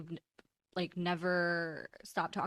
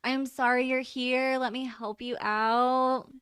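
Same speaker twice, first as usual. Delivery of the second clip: slow, bright customer service voice; 5 semitones higher, 6 dB louder, much slower than usual. Shown as a demonstration. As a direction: put on the polite shop-clerk voice. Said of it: "my customer service voice".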